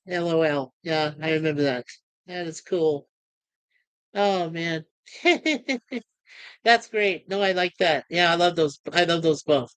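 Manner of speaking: ordinary talk, nothing marked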